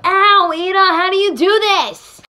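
high, loud cry of pain; pained complaint. Acted. Classmate struggling to imitate Iida, loud pained complaint. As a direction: wail it in frustrated pain. Hurt while imitating Iida's motions.

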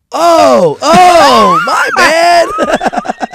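very high, loud mocking my bad; dad sarcastically yelling at the ref, very high and loud; sarcastic shout. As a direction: scream it sarcastically.

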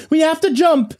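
high, urgent yell; high-pitched girl shouting; girl's yell. A narrator's reading. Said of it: "the girl yelled".

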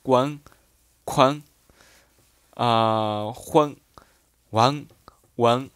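each example syllable said slowly and separately; dialect readings. Shown as a demonstration. Teacher reads dialect words to the learners, carefully. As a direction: read the dialect sounds out one by one. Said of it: "加一个乌的介音之后".

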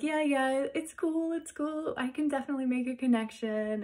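high-pitched quoted reassurance; imitated easygoing agreement. Voices man offering a connection.

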